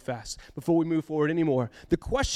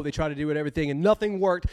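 ordinary talk, nothing marked

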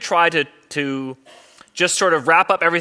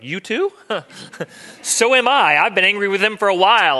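higher and louder, an incredulous "you too?"; Job's mock indignation; 6 semitones higher, 4 dB louder than usual. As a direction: Job outraged and agreeing with God. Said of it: "And Job's like".

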